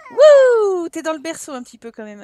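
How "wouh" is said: high loud whoop of delight; excited whoop. Real, not acted. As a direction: whoop for the dancer. Cheering dancing child. (cri de joie aigu)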